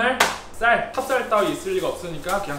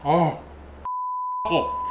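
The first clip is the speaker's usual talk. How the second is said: chewing while talking; breathing around hot bite; mouth full of hot food; much slower than usual. Real, not acted. Seen 0: Bite at -1.0, hand fans mouth, face mostly cropped later.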